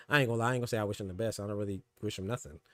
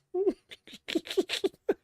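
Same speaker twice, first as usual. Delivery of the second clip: long high mocking laugh. Real, not acted. Mocking viewer Jerry.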